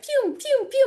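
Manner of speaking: playful pew sounds, the last one jumping way up high; ping pong sound effect. Retold or imitated. Telling students about the cat bouncing in the car, mimicking with pew sounds. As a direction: zip the sounds like a bouncing ball.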